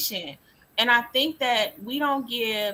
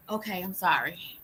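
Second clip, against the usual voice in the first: soft whispered "okay, I'm sorry"; whispered apology; 3 semitones lower, 5 dB quieter than usual. Retold or imitated. Daughter apologising to parent after being upset: soft whisper. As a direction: breathe out a small contrite apology. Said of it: "she'll be like".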